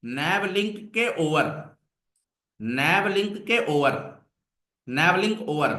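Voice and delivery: slow and careful, ओवर with no h sound; silent h. Shown as a demonstration. Teacher dictates the CSS selector slowly, with no h in ओवर. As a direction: spell out ओवर slowly, starting on the vowel. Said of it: "not होवर".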